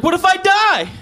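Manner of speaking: high, loud panicked squeal; hammed-up fear of dying; mock panic. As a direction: shriek it like a terrified caveman. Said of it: "he was like".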